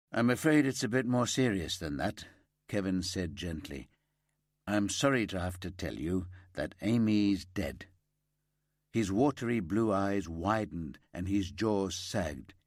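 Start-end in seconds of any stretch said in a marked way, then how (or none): none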